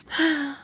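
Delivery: audible, put-upon sigh; exasperated sigh. Acted. Maria sits down beside her, exasperated sigh. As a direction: let out a fed-up sigh.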